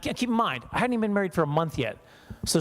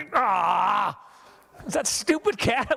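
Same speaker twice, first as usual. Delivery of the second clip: mock shout at cat; 4 semitones higher, much slower than usual. Finding cat in engine.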